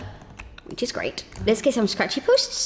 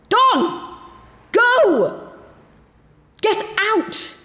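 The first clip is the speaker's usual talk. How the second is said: shouting; 13 semitones higher, much slower than usual. Real, not acted. Kicking Don out of house.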